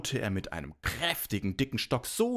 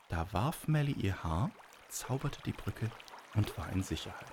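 ordinary delivery, nothing marked

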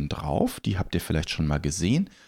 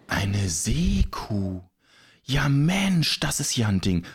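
amazed; 3 semitones higher, slower than usual. Acted. Captain learning Nelly is a sea cow, astonished. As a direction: draw it out in astonishment, then exclaim.

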